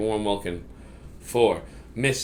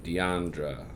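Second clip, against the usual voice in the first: ordinary delivery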